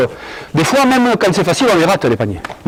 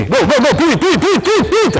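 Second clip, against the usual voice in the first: rising higher with each repeat; rapid-fire sideline calls, climbing in pitch; urgent urging; 5 semitones higher than usual. Real, not acted. Coach hurrying players through the drill, urgent rising calls.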